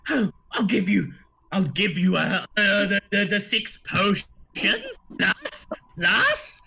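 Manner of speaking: excited gnome. Acted. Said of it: "he says".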